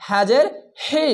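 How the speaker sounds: a throaty হি against the plain হ; breathy throat হি. Shown as a demonstration. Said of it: "normal". (গলার ভেতর থেকে হাজের হি)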